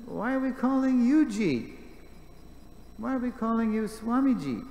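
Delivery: high, loud, demanding challenge; scolding granddaughter. Retold or imitated. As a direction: call him out like a cheeky granddaughter. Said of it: "She was like a scolding granddaughter".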